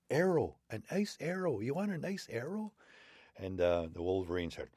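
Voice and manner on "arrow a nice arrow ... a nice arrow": high sly offer; old man wolf. Acted. Old man wolf tempting wolverine, high sly voice. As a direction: tempt him with a treat.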